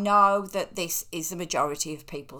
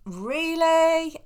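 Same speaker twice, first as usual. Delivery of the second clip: high, loud, disbelieving question; sceptical, pitch shooting up; incredulous; 10 semitones higher, 6 dB louder than usual. Real, not acted. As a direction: challenge it with a raised-pitch "Really?". Scoffs at a negative comment.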